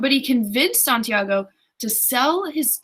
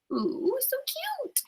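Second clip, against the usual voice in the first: very high, soft drawn-out squeal; gushing coo; 7 dB quieter, much slower than usual. Real, not acted. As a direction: coo over something adorable, slow and tiny. Reader gushes over the love line.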